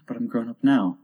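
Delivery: understated sorrowful tone; a sigh, then mild regret. A narrator's reading. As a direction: say it with a touch of sorrow. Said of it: "she added in a sorrowful tone".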